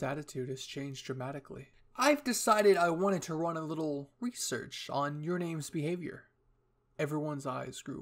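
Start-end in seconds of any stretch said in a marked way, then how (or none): 2.0-6.3 lightly theatrical announcement; cheerful eccentric commander. Acted. Hanji announcing research to the squad, mildly theatrical cheer. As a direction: declare the plan with a grand flourish.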